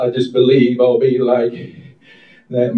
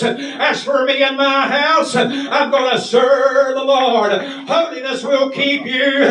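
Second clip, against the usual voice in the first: full-force preaching; 12 semitones higher than usual. Real, not acted. Preaching at full force over music.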